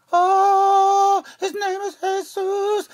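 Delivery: high, slow, awed cry of discovery; a high, drawn-out wondering voice; amazed bystanders. Retold or imitated. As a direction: gasp it out like a stunned onlooker. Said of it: "And everybody else is like".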